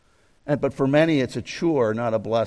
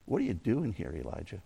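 softer, hushed second asking; hushed repeat; 8 semitones lower, 12 dB quieter than usual. Acted. God gently repeats his question to Elijah, hushed. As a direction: let it drop almost to a murmur.

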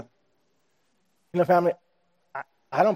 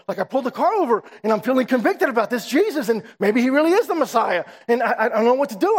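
raised, louder, breathless retelling of a panicked friend; anxious excited rush; 6 semitones higher, 3 dB louder than usual. Retold or imitated. Coworker confessing to Jim, in an urgent, worked-up voice. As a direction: blurt it out like a man who just pulled his car over. Craig panicking over his conviction.

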